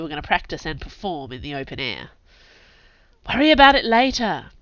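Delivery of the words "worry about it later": brisk and impatient. A narrator's reading. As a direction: wave it off, impatient. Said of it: "May said impatiently".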